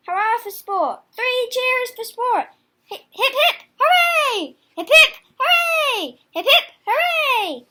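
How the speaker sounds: high, loud, laughing cheers; cheering hare. Acted. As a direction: cheer on the sport with glee. Hare cheering while jogging.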